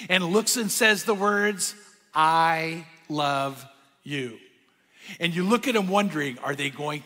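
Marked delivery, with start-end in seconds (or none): 2.1-4.4 slow, tender "I love you"; tender first I love you. Retold or imitated. To a new partner for the first time, slow and tender. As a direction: let it out slowly, like a risk.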